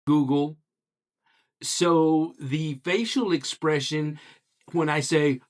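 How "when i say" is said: ordinary talk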